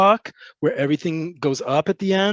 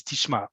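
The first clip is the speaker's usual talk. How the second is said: "Tishma" lower, dropping at the end; falling ending; 4 semitones lower, 6 dB quieter than usual. Shown as a demonstration. Teacher shows her usual way to demand attention, "Tishma" falling low. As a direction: land "Tishma" low and softer, as a statement. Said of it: "usually the way I would say it".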